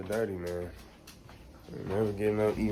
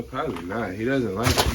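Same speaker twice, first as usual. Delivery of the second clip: usual speaking voice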